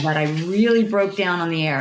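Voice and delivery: ordinary talk, nothing marked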